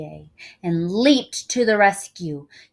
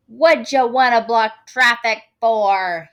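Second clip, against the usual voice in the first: loud gruff driver calling out; 4 semitones higher, 12 dB louder than usual. A narrator's reading. Limo driver calling out to the blocking crowd, loud and gruff. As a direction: yell it from the car window. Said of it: "he called out".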